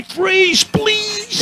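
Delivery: very high, whiny plea; begging cop. Retold or imitated. Exhausted cop begging him to stop, very high whine. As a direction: turn the command into a pitiful plea. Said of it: "I could swear I heard him say".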